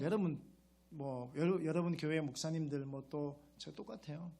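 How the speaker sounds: usual speaking voice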